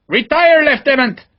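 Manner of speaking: Vivar urges Sharp to pull back, shouting; shouted order. Acted. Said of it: "Vivar shouted at Sharp".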